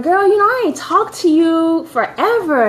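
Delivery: mother on the phone. Retold or imitated. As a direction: lightly voice a mom fussing at her child. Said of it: "I remember my mother calling me".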